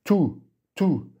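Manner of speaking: high-pitched yelped repetition; high, insistent cry; howled words. A narrator's reading. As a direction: yelp each word separately. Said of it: "que je hurle".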